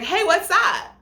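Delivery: high, loud gushing greeting; mock-perky squeal; Victoria. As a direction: imitate an over-friendly fake hello. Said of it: "Victoria's like".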